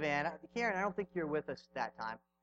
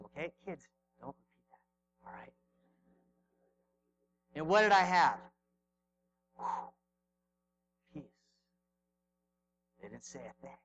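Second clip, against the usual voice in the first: ordinary delivery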